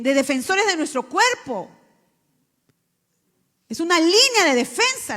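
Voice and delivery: loud, high preaching; fiery preaching. Acted. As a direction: preach it with force. Preaching loudly. (predicación fuerte y apasionada)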